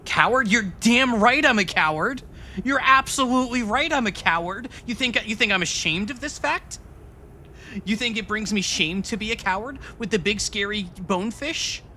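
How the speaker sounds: high-pitched defiant protest; mock indignation. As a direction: proudly own it with hammed-up outrage. Mock-defiant retort to being called coward.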